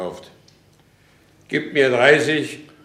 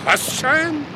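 much higher astonished echo of the word; surprised echo; 12 semitones higher than usual. Acted. Kohlhaas, astonished at the castellan's demand. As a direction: repeat it in disbelief.

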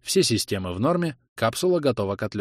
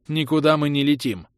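curt refusal; 6 semitones higher, 3 dB louder than usual. Acted. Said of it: "отрезала Зара".

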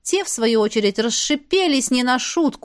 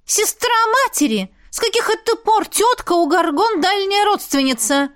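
much higher, outraged protest; indignant; 9 semitones higher than usual. A narrator's reading. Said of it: "возмутились Пикси".

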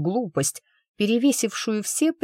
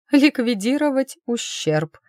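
higher, automatic echo of the phrase; mechanical echo; 7 semitones higher than usual. Acted. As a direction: repeat ликвидировать ущерб higher and absently, on autopilot. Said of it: "машинально кивнула она".

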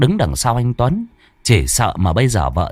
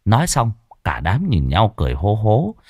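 usual speaking voice

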